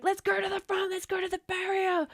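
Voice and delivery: high, eager urging; rally the group to the barrier. Retold or imitated. Urging friends at concert.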